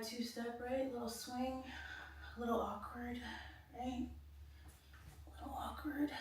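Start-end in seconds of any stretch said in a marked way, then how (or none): 5.3-6.1 airy whispered aside. Real, not acted. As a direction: whisper it to yourself. Repeating the phrase in a whisper.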